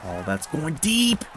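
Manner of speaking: high, loud, slow TV announcer; sportscaster. Pretend. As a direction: call the throw like a broadcaster. Mock play-by-play call.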